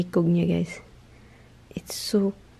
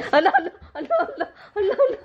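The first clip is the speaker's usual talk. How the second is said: much higher, laughing as she calls the pet; 14 semitones higher, much faster than usual. Real, not acted. Owner laughing and calling the playful pet. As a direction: call the pet through giggles.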